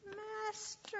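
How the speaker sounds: high, drawn-out plaintive call; lost sheep's wail; crying out. Acted. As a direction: call long and high for rescue. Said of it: "cried Blister".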